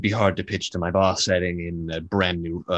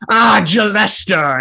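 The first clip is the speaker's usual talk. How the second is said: much higher and louder, slow and drawn out; delighted shout; 12 semitones higher, 10 dB louder, slower than usual. Acted. Volo spotting Jelester across the tavern, loud greeting. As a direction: hail an old friend across the room. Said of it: "And he says".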